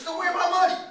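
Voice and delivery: high, loud comic demand; demanding payment. Acted. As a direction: confront him about the debt, hammed up. Dealer demands his money.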